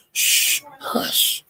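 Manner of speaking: whispered shh, hush; shushing whisper. Retold or imitated. Narrator shushing the fighting panel, whispered shh. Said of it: "I was writing hush in the chat".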